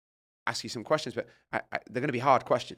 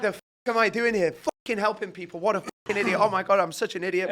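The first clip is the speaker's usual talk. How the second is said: self-berating; 7 semitones higher, 5 dB louder than usual. Retold or imitated. Berating himself in retold thought.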